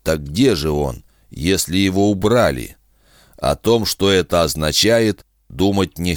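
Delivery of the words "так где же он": ordinary delivery, nothing marked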